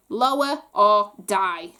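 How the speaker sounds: slow, loud menacing order; slow, loud goblin threat. Acted. Goblin prince threatening kneeling family.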